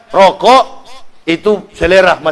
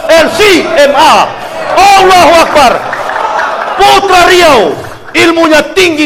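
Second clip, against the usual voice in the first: fervent shout; 8 semitones higher than usual. Real, not acted. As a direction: rouse the crowd with it. Fervent praise of Abdul Somad.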